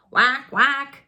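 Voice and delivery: loud duck whack; duck call. Acted. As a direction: quack it loudly like a duck. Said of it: "she said".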